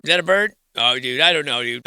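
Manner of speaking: ordinary talk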